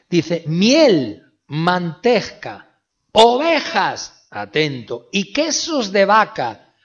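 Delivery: full-force pulpit proclamation; booming preaching. Acted. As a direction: thunder the list at the congregation. Preacher booming the scripture list.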